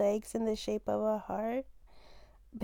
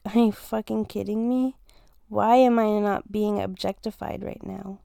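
ordinary delivery

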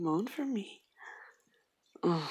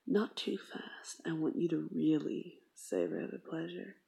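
normal talking voice

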